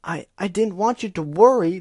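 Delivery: loud, high defensive shout; shouting back. Acted. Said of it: "the Pegasus shouted".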